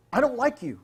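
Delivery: mock angry shout at the director; loud, snapping mock rant; half-serious shouted insult; retold yell. Producer screaming at him.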